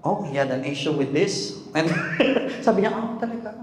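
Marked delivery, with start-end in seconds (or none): 2.9-3.6 hushed mock curiosity; quietly intrigued listener; suddenly interested. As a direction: act intrigued in a soft, higher voice. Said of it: "how we make her interested".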